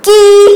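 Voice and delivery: put-on teacher modelling की for young pupils; model syllable. Shown as a demonstration. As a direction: stretch out की so children hear the long ee vowel. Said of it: "इसका उच्चारण क्या बनेगा?".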